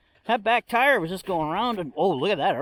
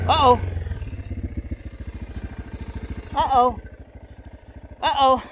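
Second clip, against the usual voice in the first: worried uh-oh; 4 semitones higher than usual. Real, not acted. Truck about to slide.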